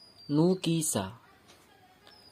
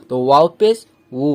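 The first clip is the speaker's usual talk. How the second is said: ordinary delivery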